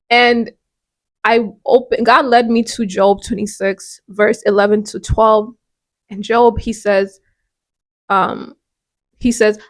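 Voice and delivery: ordinary delivery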